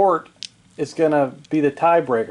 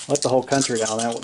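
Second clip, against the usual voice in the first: normal talking voice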